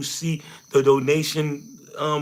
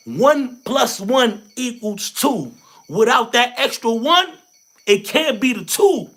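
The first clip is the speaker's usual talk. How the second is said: slow, loud, higher emphatic preaching; preaching louder; 6 semitones higher, 5 dB louder, slower than usual. Real, not acted. Urging viewers to join, loud slow preaching. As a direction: drive the point home like a sermon.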